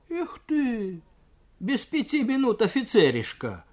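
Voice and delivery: high, sneering voice of a haughty cadet; junker's sneer. Acted. Junker mocking the worker, high sneering jeer. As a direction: look down your nose and sneer it out.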